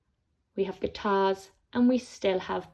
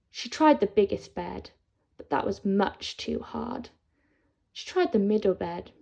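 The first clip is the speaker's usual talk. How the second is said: ordinary delivery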